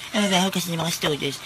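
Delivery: quiet mocking echo; mocking mimicry. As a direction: parrot the snooty complaint back under your breath. Student mockingly repeats sir's complaint.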